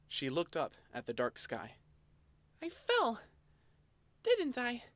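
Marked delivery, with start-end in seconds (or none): 2.6-4.8 high small scared voice. Acted. Elaine asking the Oshawott whether she fell, high and timid. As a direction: ask it timidly, high-pitched.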